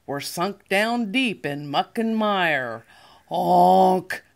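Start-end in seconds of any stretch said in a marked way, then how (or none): 3.3-4.2 scared honk. Acted. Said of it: "cried the dump, and he sounded scared".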